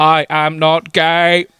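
slow robotic monotone; Dalek voice. Acted. Dalek rebuffs his human friend, slow mechanical monotone. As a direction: protest as a stiff Dalek. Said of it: "He replies in his delicate scream".

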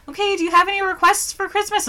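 high sweet asking voice; sweet mom. Retold or imitated. Mom asking her daughter about Christmas requests, high and sweet. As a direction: ask sweetly like a caring mom.

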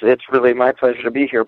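normal talking voice

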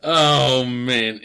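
ordinary talk, nothing marked

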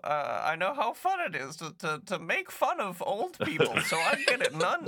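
put-on high, laughing old lady voice; a prim old woman taking mock offense. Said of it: "this old lady voice".

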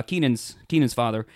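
ordinary talk, nothing marked

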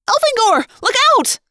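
high, loud warning scream. A narrator's reading. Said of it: "Lauren screamed".

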